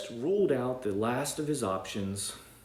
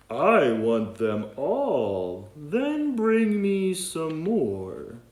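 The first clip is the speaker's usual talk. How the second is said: very slow, loud, drawn-out demand; greedy whale; 6 semitones higher, 9 dB louder, much slower than usual. Acted. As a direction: stretch every word like a huge greedy whale. Whale greedily demands all donuts.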